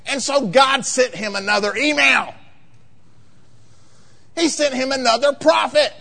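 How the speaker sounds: high, loud, emphatic sermon delivery; impassioned pulpit proclamation, pitch held high; full-force preaching. Real, not acted.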